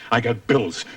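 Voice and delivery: ordinary delivery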